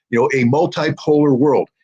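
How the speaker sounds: normal talking voice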